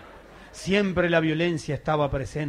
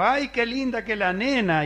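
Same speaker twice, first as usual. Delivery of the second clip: lightly voiced father, cooing a little higher and slow; doting father; 6 semitones higher, slower than usual. Father at the maternity ward, mildly cooing over the baby. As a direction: gush over the baby like a proud dad. Father delighted at newborn daughter.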